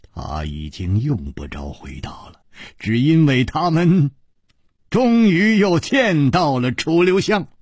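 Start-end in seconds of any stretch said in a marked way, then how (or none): none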